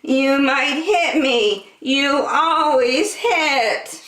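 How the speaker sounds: high, slow lamb voice; Lambkin's voice. A narrator's reading. Lambkin warns the hamster, high and slow. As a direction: draw it out like a wary little lamb. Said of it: "said Lambkin".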